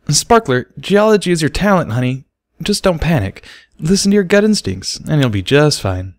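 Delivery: mild offhand reassurance; dismissive. Acted. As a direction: wave the worry away. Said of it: "Barry said dismissively".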